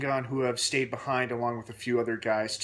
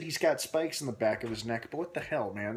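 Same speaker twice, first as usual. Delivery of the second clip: ordinary talk, nothing marked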